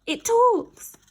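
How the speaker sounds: high, loud shocked cry; terrified girl shrieking at a talking blob; frightened scream. Acted. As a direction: shriek it in disbelief. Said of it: "screamed Jemima".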